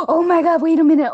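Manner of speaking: high gasp, then loud, high "oh my God"; a loud sharp gasp as it hits you; sudden excited gasp. Real, not acted. Sudden remembering of favorite scene.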